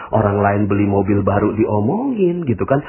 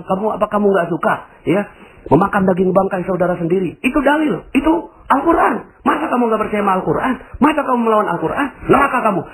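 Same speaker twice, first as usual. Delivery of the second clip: high, self-righteous rebuke; preachy scolding; 9 semitones higher, faster than usual. Retold or imitated. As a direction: lecture them with hellfire. Orang yang menghakimi penggosip. (menegur dengan nada tinggi sok suci)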